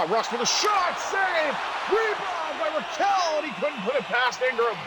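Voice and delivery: high-pitched burst of hockey commentary; excited play-by-play. Real, not acted. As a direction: call the shot and save with rising excitement. Live call of a shot.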